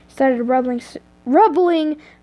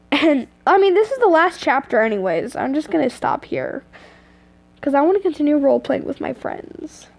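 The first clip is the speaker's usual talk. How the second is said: plain reading voice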